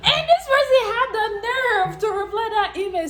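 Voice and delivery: singsong, high-pitched indignation; indignant outrage. Real, not acted. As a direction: wail in disbelief at the cheek of it. Outraged at scammer's nerve to reply.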